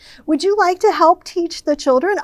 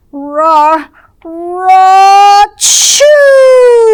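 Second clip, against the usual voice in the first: ra-, ra-, chew rising to a much higher, louder sneeze; building sneeze; 7 semitones higher, 9 dB louder, much slower than usual. Acted. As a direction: let the sneeze build then burst out.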